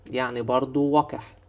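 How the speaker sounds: ordinary talk, nothing marked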